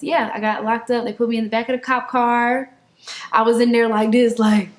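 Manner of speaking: ordinary talk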